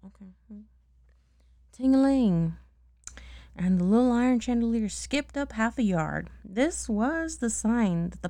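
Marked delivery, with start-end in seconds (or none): 1.7-2.6 bell sound. Acted. Reader voices chandelier bell.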